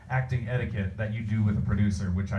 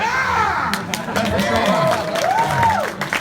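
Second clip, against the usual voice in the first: long, very high, very loud drawn-out yell; power-up scream; 6 dB louder, much slower than usual. Pretend. The crowd asks for more, a long high screaming finish. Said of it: "[name], give us a come here".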